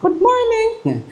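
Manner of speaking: high, slow and comic good morning in a squeaky voice; high falsetto mock greeting; second man's voice. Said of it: "iba-iba yung boses".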